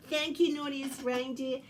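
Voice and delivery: ordinary delivery, nothing marked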